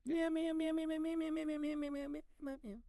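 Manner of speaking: very high meows, fading away; endless cat meowing. Pretend. Cat host on relying on Rick, high fading meowing. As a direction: keep squeaking meows until they die away.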